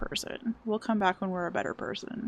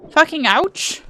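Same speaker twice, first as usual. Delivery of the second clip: louder and higher, yelping; loud pained shout; 6 semitones higher, 10 dB louder, slower than usual. Real, not acted. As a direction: shout it like you just got hit. Louder repeat of the pain cry.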